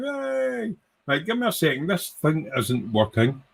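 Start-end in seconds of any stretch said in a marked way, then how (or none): 0.0-0.7 excited cheer. Real, not acted. Welcoming a viewer to the stream, high happy yay. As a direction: cheer a friend arriving in chat.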